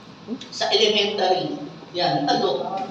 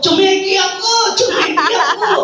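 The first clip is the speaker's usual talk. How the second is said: higher and louder, boasting with a laugh; proud boast; 8 semitones higher, 3 dB louder than usual. Retold or imitated. Bragging to others about earning a cheque, high, loud and excited. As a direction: crow about the cheque like a winner. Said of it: "So, pinagmalaki ko".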